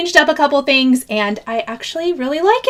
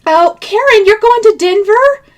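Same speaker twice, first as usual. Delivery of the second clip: higher, louder surprised question; 9 semitones higher, 6 dB louder than usual. Real, not acted. Host reacts to Karen's Denver trip, higher and louder surprise. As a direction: sound delighted and surprised by the news. Reading viewer's travel news.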